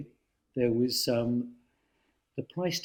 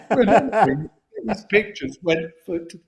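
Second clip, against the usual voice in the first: laughing; 7 semitones higher, 8 dB louder than usual. Real, not acted. Laughing at his own punchline.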